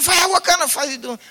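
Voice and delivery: urgent question. Retold or imitated. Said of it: "He said".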